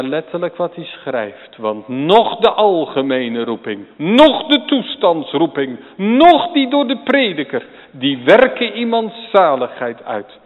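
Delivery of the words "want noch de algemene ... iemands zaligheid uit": rising louder and higher, hammering each noch; building to full preaching force. Acted. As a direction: pound out each clause. Preacher reads Spurgeon, building.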